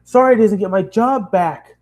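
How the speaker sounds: high, loud sarcastic scream; yelling back at the girl's apology. Acted. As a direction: scream it back at her, bitter. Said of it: "I screamed at her".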